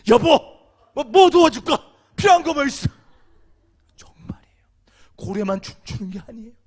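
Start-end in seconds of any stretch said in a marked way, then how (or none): 0.1-2.9 higher and louder, keen to help; eager husband. Pretend. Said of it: "정말 그런지 알고요". 4.0-4.6 soft unvoiced 정말이에요; whispered assurance. Real, not acted.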